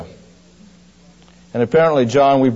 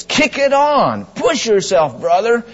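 coach urging on; 7 semitones higher, slower than usual. Acted. Runner pushing to finish.

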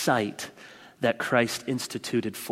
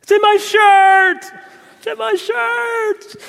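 put-on much higher and louder, a panicked child's scream; screaming toddler; 10 dB louder, slower than usual. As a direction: shriek it like a terrified three-year-old. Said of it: "starts screaming".

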